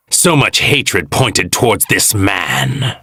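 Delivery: usual speaking voice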